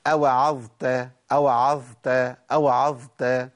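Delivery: أوعظت drawn out slowly, ظ held into the ت; slow recitation demo; running ظ before ت. Shown as a demonstration. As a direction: stretch the ظ in أوعظت. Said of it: "سامعينها".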